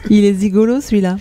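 zigolo, z for g lisped. Acted. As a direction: say zigolo with a lisp for gigolo. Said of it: "la petite Lucie qui zozote".

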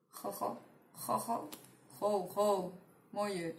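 Jojo with a Spanish j, tested quietly; "Jojo Moyes" tried softly with throaty j; trying a pronunciation. Shown as a demonstration. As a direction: sound out "Jojo" as "ho-ho", uncertainly. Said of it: "no sé cómo se pronuncia".

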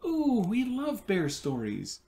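high, lightly voiced child delight; excited exclaim. A narrator's reading. Dee tells Miss Merriweather she loves bears, excited. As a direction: gush like a thrilled little girl. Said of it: "Dee exclaimed".

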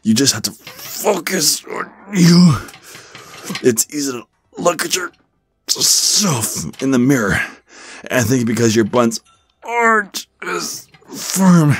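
high, squeezed bursts between reps; straining under weight. Acted. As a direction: talk through a heavy bench press. Talks while bench pressing huge weight.

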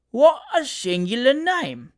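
slow, high, amazed exclaim; surprised exclamation. A narrator's reading. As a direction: marvel at it, drawn out. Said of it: "exclaimed the gentleman".

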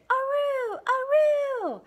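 high, loud, long howling aroo; dog howl. Acted. Dog howls for joy at the kittens, loud and high. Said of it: "she howled".

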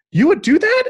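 high, teary, amazed question; a wife choked up with grateful surprise; wife overwhelmed that her husband will cook, high teary disbelief; tearful disbelief. Retold or imitated. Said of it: "she burst into tears and she's like".

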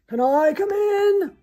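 high, very loud snarl; snarling visitor. A narrator's reading. Lion knocks at the door, snarling. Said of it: "knocked and snarled".

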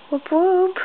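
mock cheer. Real, not acted. Mock cheer about Netflix.

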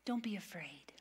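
soft unvoiced whisper, tender; whispered reassurance. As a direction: breathe it like a secret comfort. Jesus comforting disciples.

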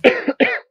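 harsh chesty cough. Real, not acted. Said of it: "Sorry, I'm coughing".

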